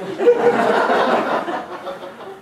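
squealing high laughter. Real, not acted. Teller laughing at punchline.

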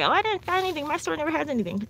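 high whiny imitation of complaining shoppers; mocking complainers. To her viewers, mocking shoppers who never find anything, high whiny voice. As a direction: mimic the lazy complainers. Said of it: "People say".

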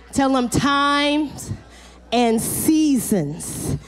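slow drawn out. Shown as a demonstration. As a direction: stretch every word slowly. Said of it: "drawn out for the congregation".

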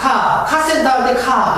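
car said as 카 with no r; r 없는 영국식 카; British car. Shown as a demonstration. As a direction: drop the r in 카 like the British. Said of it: "영국식".

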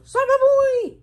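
very high, loud playful shout; gleeful shout. Acted. Announcing the game to the only boy, a gleeful high shout. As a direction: yell it like a game call.

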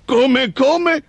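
high-pitched baffled creature; puzzled ogre. A narrator's reading. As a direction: ask it as a confused, high-voiced ogre. (orco perplesso, voce acuta)

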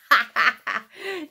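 high, loud giggle; real laugh. Real, not acted. Reader to child after the silly story, laughing.

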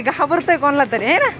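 ordinary delivery

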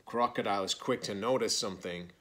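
ordinary delivery

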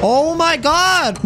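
high, drawn-out cry of disbelief; exasperated outburst. Real, not acted. Player whose character closed the door, exasperated high cry. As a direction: stretch it out in real outrage.

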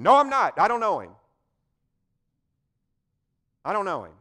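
loud, high, frantic denial; panicked denial. Acted. A preacher voicing Peter, loud and high in panicked denial. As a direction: Peter shouts he never knew him.

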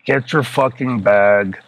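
halting, piece-by-piece recital with long gaps; remembering in pieces. Retold or imitated. Arnie relaying the boss's verbatim message, halting recall. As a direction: relay it slowly in chunks, groping for the next bit. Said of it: "trying to remember it in pieces".

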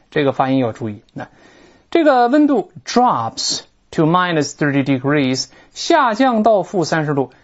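ordinary talk, nothing marked

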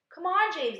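high, loud, pleading urging; insistent, sing-out encouragement; coaxing. A narrator's reading. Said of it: "coaxing him".

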